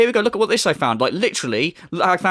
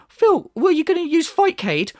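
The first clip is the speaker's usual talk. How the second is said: mock viewer voice; 9 semitones higher than usual. Imagined viewer asking in chat.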